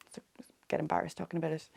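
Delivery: embarrassed whisper. Real, not acted. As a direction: whisper it shyly, like a confession. Shy aside about anniversary.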